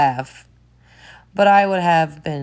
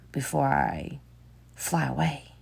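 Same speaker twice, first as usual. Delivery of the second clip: quiet, hushed aside; hushed voice tracking the chase; hushed commentary; 13 dB quieter, faster than usual. Real, not acted. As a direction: murmur as if not to disturb. Quiet commentary over the video.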